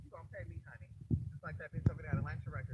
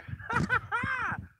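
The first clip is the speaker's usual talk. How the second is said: high-pitched cackling laugh. Real, not acted. Host laughing before plugging her show, high laugh.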